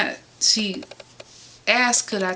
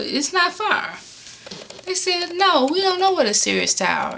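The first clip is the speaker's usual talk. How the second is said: frustrated; 10 semitones higher than usual. Retold or imitated. Quoting callers, rising frustration.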